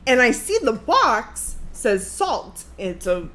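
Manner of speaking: ordinary talk, nothing marked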